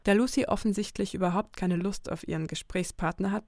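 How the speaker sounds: normal talking voice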